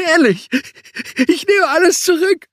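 high, loud, panicky plea; pleading Sebastian. A narrator's reading. Sebastian begging the giant to stop, high and panicky. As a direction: beg him to stop.

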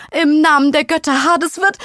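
high, loud, climbing tantrum; voice rising an octave. Acted. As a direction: pitch shooting up in a rage. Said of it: "ihre weiche Stimme hob sich um eine Oktave".

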